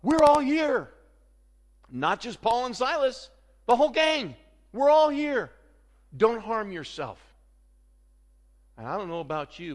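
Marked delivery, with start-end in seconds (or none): 0.0-0.9 high, loud urgent yell, building; shouting. Acted. Paul stopping the jailer from harming himself, shouting. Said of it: "He shouted". 1.9-7.2 high, loud calling out, easing slightly at the end; shouting. Acted. Paul telling the jailer that all prisoners remain, shouting. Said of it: "He shouted".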